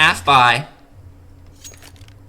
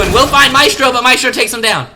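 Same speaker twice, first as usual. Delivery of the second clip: excited; 6 semitones higher, 5 dB louder than usual. Real, not acted. Sudden kill called live.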